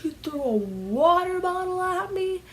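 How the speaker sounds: put-on accusing teenager. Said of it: "the girl said".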